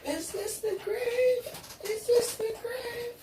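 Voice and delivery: high, slow crying ghost voice; ghostly wail. Acted. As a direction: wail it like a lost spirit.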